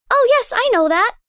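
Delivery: put-on high, wondering child; a little girl full of awe; young girl voice. A narrator's reading. Daughter tells her mother about the moonlit lake, in wonder.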